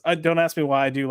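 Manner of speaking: normal talking voice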